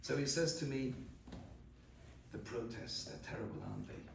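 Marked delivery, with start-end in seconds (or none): 2.3-4.0 low, much softer, confiding question; hushed probing. Retold or imitated. Passport officer quietly sounds out the traveller, hushed. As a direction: lower your voice as if testing the waters.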